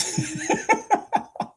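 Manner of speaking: breathy high giggle of delight; a giddy laugh after a big win. Real, not acted.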